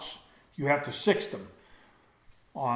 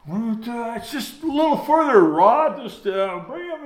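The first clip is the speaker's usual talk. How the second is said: much higher placating voice; coaxing brother; 9 semitones higher, 6 dB louder than usual. Retold or imitated. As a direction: coax him along, pitched way up. Coaxing brother onward up rocks.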